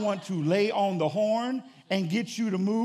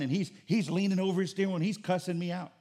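normal talking voice

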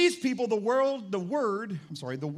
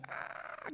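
hushed, voiceless mock scream; imitated crowd scream; 12 dB quieter than usual. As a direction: fake a roaring crowd under your breath. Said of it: "people were screaming and shouting".

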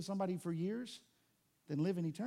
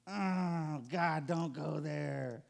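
mock reluctant groan; 3 semitones lower, much slower than usual.

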